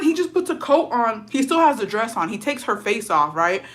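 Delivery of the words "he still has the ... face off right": usual speaking voice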